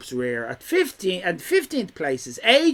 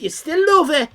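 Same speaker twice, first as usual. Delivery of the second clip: teasing; 8 semitones higher, 5 dB louder than usual. Acted. Teasing a chat member.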